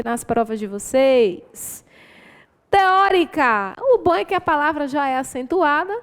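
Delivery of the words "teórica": "Teórica" bem devagar e mais alto, com força no "ó"; sílaba tônica marcada. Shown as a demonstration. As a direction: estique "Teórica" marcando o "ó" da antepenúltima. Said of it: "Sobre a posição da sílaba tônica". (professora mostra a sílaba tônica de "Teórica" devagar)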